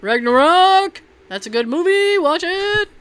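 high, excited hype; excited plug. Acted. Host urging listeners to watch Ragnarok, excited high plug.

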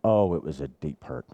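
ordinary talk

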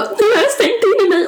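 high, whiny imitation of sobbing; mock crying. Imitating his tearful bathroom video for friends, mock crying. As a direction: wail it in a squeaky fake cry. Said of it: "och gråter och bara".

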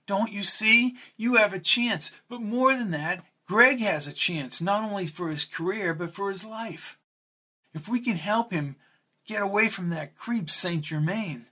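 lightly voiced urgent plea; pleading character voice.

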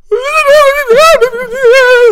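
high, loud, slow sobbing; crying. Retold or imitated. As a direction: break down crying mid-sentence. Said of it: "second wave of inaudible words".

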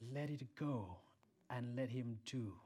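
ordinary talk, nothing marked

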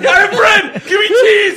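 very high, loud, giddy yelling; excited shout. Retold or imitated. Behind the bar, yelling to Igor for another word, very high and loud. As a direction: yell eagerly for the next word.

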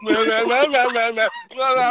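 mimicking muffled shouting; mock shouting gibberish; garbled mock yelling. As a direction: nonsense rah rah to mock noise. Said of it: "then he's like".

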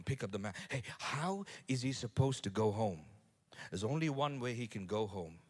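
ordinary delivery

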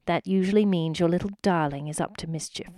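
ordinary delivery, nothing marked

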